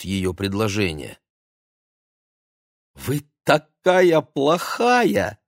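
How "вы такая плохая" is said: high, slow, drawn-out mock reproach; giggly teasing. A narrator's reading. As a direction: tease her with stretched, high words. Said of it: "хихикнула Кира".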